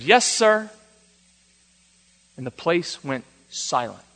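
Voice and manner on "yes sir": high imitation of an obedient boy; imitated child's reply; little boy. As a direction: answer like a small kid, pitched up. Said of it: "So the boy said".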